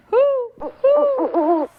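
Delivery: high, loud hooting; owl hoot. Acted. Reading to kids, a high owl hoot. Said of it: "says the owl".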